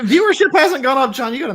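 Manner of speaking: high, loud mock outrage; loud teasing. Real, not acted. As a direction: call him out loudly. Teasing outburst.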